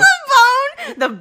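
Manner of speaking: said through loud laughter; laughing. Real, not acted. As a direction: laugh out the words. Laughing while answering.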